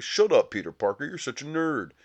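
mock bully taunt. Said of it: "Flash Thompson's like".

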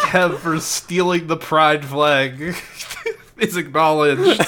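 struggling to get it out while laughing; laughter breaking into a grand proclamation. Real, not acted. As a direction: announce it through giggles. Laughing while announcing godly claim.

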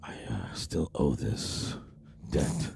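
gruff whispered movie hero. Retold or imitated. Quoting movie character line.

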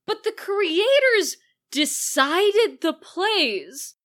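high, slow emphatic protest; drawn-out indignant argument; exasperated objection. Retold or imitated. Acting out own exasperated objection.

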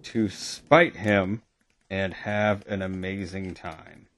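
slow, quiet dictating while typing; slow dictation. Real, not acted. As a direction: read out each word as you type. Slowly dictating what she types.

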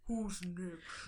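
tough guy voice. Acted. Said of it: "that's his voice for the rest of the book".

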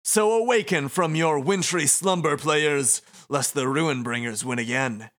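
loud, high dramatic taunting; theatrical game master. Acted. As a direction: proclaim it like a showman villain. Voices game master Shiba.

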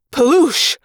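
a loud burst of "paloosh!"; scene: narrator voicing dirt exploding ahead, punchy sound effect; spoken explosion noise. A narrator's reading.

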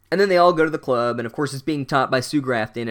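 normal talking voice

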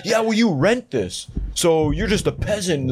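slow, high, mocking put-down; hammed-up snotty thief; sneering thief. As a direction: drag it out with contempt. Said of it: "just talking shit about this guy".

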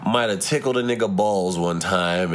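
ordinary talk

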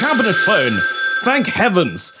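stagey, excited relief; relieved exclamation. Acted. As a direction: exclaim with sudden hope. Sudden hope as phone rings.

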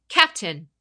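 sharp loud call; barked command. Acted. Commander calling her captain to attention, barked loud. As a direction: snap it out to get attention. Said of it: "she barked".

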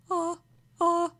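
high, held "ah" like a choir preset; synthetic choir "ah", pitched high; imitated keyboard choir. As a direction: mimic a cheap keyboard's choir setting. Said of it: "the ones that are just like".

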